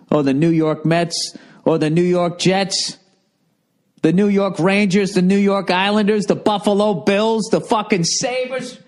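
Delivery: high, mounting mock-belittling list; teasing rant. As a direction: pile up the names in a rising taunt. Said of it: "I'm fucking with you".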